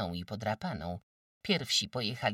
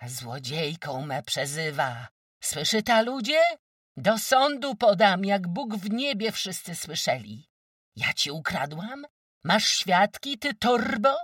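indignant shout; 11 semitones higher, 9 dB louder than usual. Acted. Quarrel, calling the neighbours.

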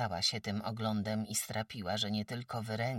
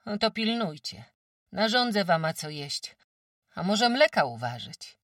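higher, louder village farmwife; a brisk countrywoman sizing up a sick guest; sturdy farmwife; 10 semitones higher, 9 dB louder than usual. Acted. Farmwife Kłębowa tells old Agata she's too weak, brisk.

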